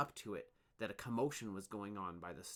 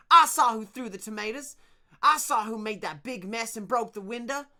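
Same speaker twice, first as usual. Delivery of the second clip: very high, loud and excited; breathless and shrill; 12 semitones higher, 16 dB louder than usual. Acted. Torin panting his story to the workers, shrill. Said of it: "his shrill, high voice".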